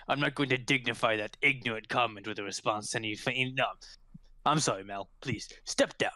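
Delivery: low, dignified official voice; indignant constable. Acted. Constable refusing to dignify an ignorant comment, stiff indignation. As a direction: dismiss it with stiff formality. Game master plays the constable.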